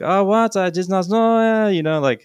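high, loud mock French accent; fake French accent. Friend feigning ignorance to a policeman, fake French accent. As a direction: play a clueless tourist in hammed-up French. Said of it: "he said he used a French accent".

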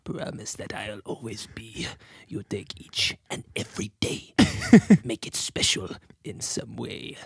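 eerie whispered song; creepy whisper. Acted. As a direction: sing it in a hushed, creepy whisper. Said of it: "Creepy, creepy".

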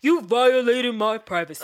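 loud mock indignation; hammed-up accusing quote; mock outraged accusation. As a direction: imitate his outraged complaint. Acting out his accusation.

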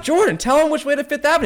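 high, loud, fast insistence; urging friend. Retold or imitated. Visitor urges his friend to answer the blind man, excited. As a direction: push your friend to help, fast.

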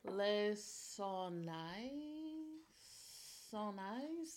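very slow, questioning attempt; unsure pronunciation. Real, not acted. Host attempting the French wine name, unsure. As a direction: sound it out with a rising, uncertain ending. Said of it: "don't even know how to pronounce".

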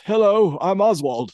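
high, loud hello in a put-on voice; put-on high voice, punched greeting; imitated commercial character. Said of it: "turns around at the computer and he goes".

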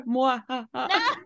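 high mock villain cackle mwah ha ha; playful fake evil cackle; evil laugh. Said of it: "You gotta do the laugh".

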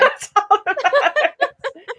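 high, slow, giggly repeat of the line; laughing echo. Real, not acted. Echoing her co-host's quip through laughter, high and stretched.

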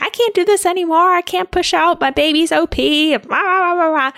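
high, fast, pleading whine of defeat; desperate giving up. Retold or imitated. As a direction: beg like you have nothing left. Exhausted pushing in labor.

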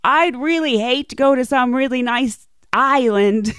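sly hint. Retold or imitated. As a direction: plant the seed with mock reluctance. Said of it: "she goes".

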